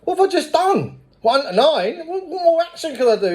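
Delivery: mock-outraged disbelief; incredulous protest. Disbelief at more steps.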